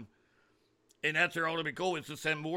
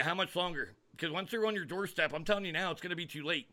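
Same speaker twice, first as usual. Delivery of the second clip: ordinary talk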